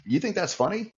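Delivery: high, pointed challenge, half offended; mock-stern interviewer pressing the candidate; incredulous challenge. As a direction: call him out for laughing. Interviewer confronts laughing candidate.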